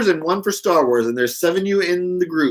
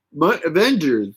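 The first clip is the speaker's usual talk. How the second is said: usual speaking voice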